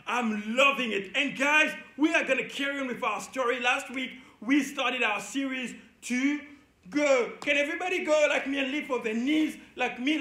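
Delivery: usual speaking voice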